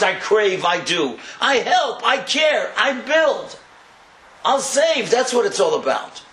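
high, loud impassioned preaching; fervent preaching. Acted. Rabbi explaining Hashem's promise to the crowd, loud and fervent. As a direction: build to a crescendo of comfort.

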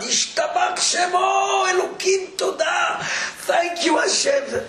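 high, drawn-out cry of thanks; retold joyful screaming. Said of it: "starts screaming out loud".

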